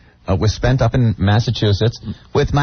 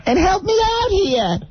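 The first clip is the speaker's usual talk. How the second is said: put-on mother-in-law's Boston accent; 13 semitones higher than usual. Mother-in-law asking him to fix the tub, in a high Boston accent. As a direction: do her Boston accent, saying cock for caulk. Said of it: "with the Boston accents".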